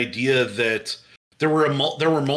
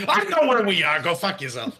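put-on Brooklyn tough-guy accent, higher at first; New York accent; 4 semitones higher than usual. Detective snapping at his questioner in a New York accent. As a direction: throw it back like a cranky New York cop. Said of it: "Why do you sound like you're from New York?".

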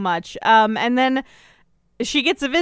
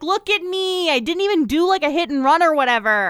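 high-pitched, exaggerated self-justifying protest; imitating an indignant nun arguing; mock indignant plea; 6 semitones higher than usual. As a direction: plead your case in a hammed-up high voice. Acted plea to lead nun.

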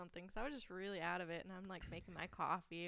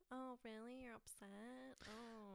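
higher, syrupy mock sympathy; passive-aggressive; 5 semitones higher, 7 dB quieter, much slower than usual. Coworker imitating manager mocking a complaining customer, passive-aggressive. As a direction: fake concern with a sneer underneath. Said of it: "very passive-aggressive".

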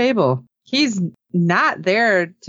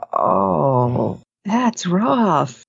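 long, sympathetic "oh", mildly tearful; a little choked up; much slower than usual. Retold or imitated. Podcaster reacts to a last wish, mock choked-up sympathy. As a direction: retell being moved, lightly choked up. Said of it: "a little choked up".

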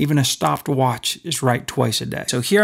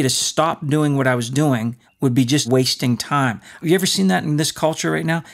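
ordinary talk, nothing marked

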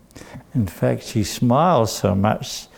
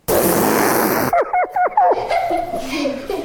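long, high burst of held-in laughter; imitated giggle outburst. Jean loses control in the meeting, bursting giggle. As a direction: burst out giggling after trying not to. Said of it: "she went".

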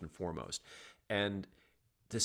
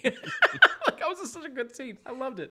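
delighted laughing; 7 dB louder than usual. Real, not acted. Delighted laughter recalling scene.

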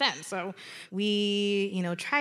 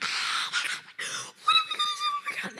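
very high squeaky mock panic; hammed-up panicked squeal under the breath; freaking out; much faster than usual. Friends spotting the moose, freaking out. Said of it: "they're, like, freaking out".

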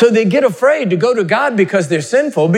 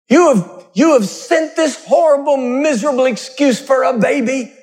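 laying a curse; 7 semitones higher than usual. Retold or imitated. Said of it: "And he would curse them".

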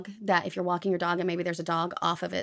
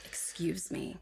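much softer, falling, clipped warning; quiet stern excuse me; 11 dB quieter, slower than usual. Shown as a demonstration. Cohost demoing the phrase, quiet and stern. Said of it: "Excuse me" works the same thing".

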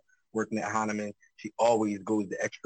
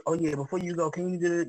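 a touch higher, breezy mock ask; co-host voicing the last-minute request; mimicking coworker; 6 semitones higher than usual. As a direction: lightly mimic the casual favor. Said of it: "You go on vacation next week".

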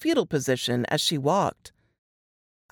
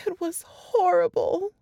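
higher, strained voice of a frightened woman; Laura reliving the horror, voice pitched up; shaken young woman; 14 semitones higher than usual. A narrator's reading. Laura telling Jess and Nate her ordeal, higher and shaken.

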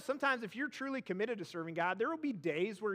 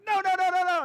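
loud shrill mock protest, much higher; toddler tantrum; 10 semitones higher, 11 dB louder, faster than usual. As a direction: yell "No, no, no" like a three-year-old throwing a fit. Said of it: "Emery goes ballistic".

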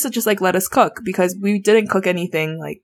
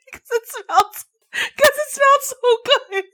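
high, squeaky, strained voice; real high-pitched near-laughing whine; embarrassed confession. Real, not acted.

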